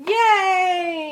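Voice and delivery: loud high cheer. Real, not acted. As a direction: shout yay with delight. Cheering the episode idea.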